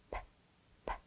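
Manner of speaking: "P" as a bare puff of air with no voice; teacher modelling the sound "P"; isolated P sound. Shown as a demonstration. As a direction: pop the lips on "P" and just blow. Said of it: "Can you hear that P sound?".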